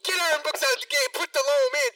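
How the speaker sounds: high, loud fed-up yell; angry fan shout. Retold or imitated. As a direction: yell it from the stands. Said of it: "We were like".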